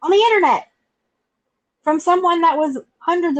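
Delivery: loud, high indignant outburst; on the internet, cry it out high and loud; exclaiming loudly. Real, not acted. Emphatic outburst about bullying.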